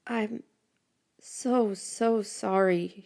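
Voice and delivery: slow, hesitant broken apology; remorseful. Acted. Ashamed boy handing back her bracelet, slow faltering apology.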